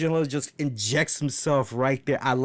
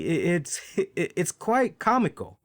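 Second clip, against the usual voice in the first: usual speaking voice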